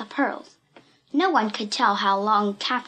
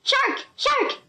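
much higher urgent shout of warning; alarmed cry. A narrator's reading. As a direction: cry out the danger. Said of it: "cried Momo".

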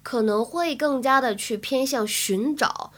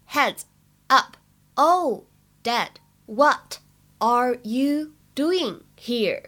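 very slow, each word on its own; much slower than usual. Shown as a demonstration. Teacher models the sitcom line for learners, slow and separated. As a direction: read "Heads up, oh, Dad" word by word with gaps. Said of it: "在整句话的朗读过程当中呢".

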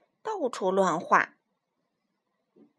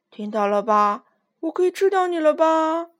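loud, gloating lion voice, higher on 吃; smug; 5 semitones higher, 7 dB louder than usual. Acted. Lion gloating to Minus after clowns' verdict, smug loud. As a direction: gloat over the clowns' answer. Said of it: "得意地对米努斯说".

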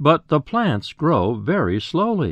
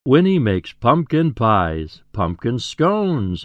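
ordinary delivery

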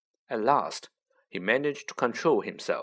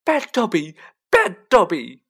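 high, loud elf shout, growing louder; 8 semitones higher, 10 dB louder than usual. A narrator's reading. Elf banging his head in self-punishment, shouting high. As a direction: shriek the self-scolding in a squeaky voice.

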